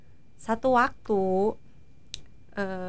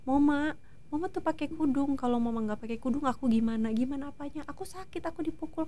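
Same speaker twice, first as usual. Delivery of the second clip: put-on a soft, higher child's plea, a touch tearful; hint of crying child; 4 semitones higher, 7 dB quieter than usual. Child in a dream pleading with mother, softly and mildly tearful. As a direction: quietly voice the little one, mildly tearful. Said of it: "di mimpi aku dia nangis-nangis".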